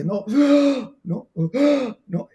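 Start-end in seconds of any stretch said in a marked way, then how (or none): 0.3-1.0 sharp, high demonstrated gasp; mock fright intake of breath; startled gasp. Shown as a demonstration. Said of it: "haces un gesto como este". 1.3-2.0 short demonstrated gasp of fright; mock scare gasp; startled gasp. Shown as a demonstration. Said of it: "haces un gesto como este".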